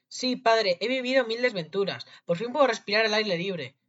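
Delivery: high, loud, eager voice; excited relief. A narrator's reading. Rescued boy to his father, loudly relieved. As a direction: pour it out, free at last.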